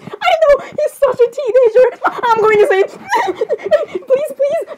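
high, squealy fangirl giggles; words dissolving into high giggles; giddy giggling. Acted. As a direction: gush helplessly through laughter. Giggling over the boy's voice.

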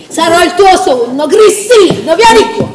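high, loud call across distance; calling from far away. Acted. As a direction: call him over from afar. Calling Cristino to come.

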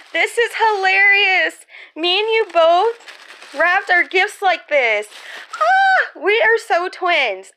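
high, loud squeal of recognition; delighted amazement. Real, not acted. Vlogger finds her friend wrapped gifts like hers, delighted. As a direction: gush loudly, pitched right up. Discovers matching gift wrap, squealing.

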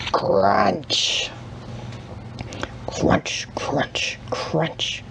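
breathy crunching noises that die away; whispered crunch sounds. A narrator's reading. Reading to children, hushed crunch sounds as the guinea pig eats.

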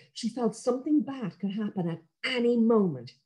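usual speaking voice

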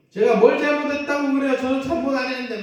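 child shouting back at scolding mom, mock defiant; mock child yelling. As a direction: play the sulky kid talking back. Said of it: "막 그렇게 소리 지르고요".

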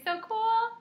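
squealed high and loud so cool; excited cheer. Shown as a demonstration. Teacher squeals a cheer for the class, high and loud.

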